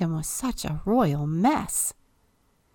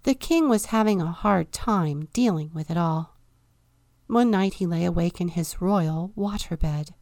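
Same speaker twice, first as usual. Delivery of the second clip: plain reading voice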